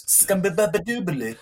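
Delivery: high, silly scatted nonsense word; mock scat gibberish. Host imagines his foreign-language attempt, high scat. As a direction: blurt it like a jazz scat. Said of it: "would have just come out as".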